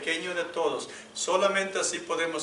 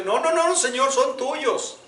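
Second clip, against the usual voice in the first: pleading Moses; 8 semitones higher, 4 dB louder, slower than usual. Retold or imitated. Moses refusing, God said "your people.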